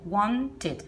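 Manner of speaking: wanted as "wan-tid"; wanted with two distinct syllables; ID ending. Shown as a demonstration. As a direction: sound the -ed in wanted as "id". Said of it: "Con una I D".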